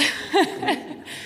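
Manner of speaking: nervous giggle. Real, not acted. Nervous laugh after introduction.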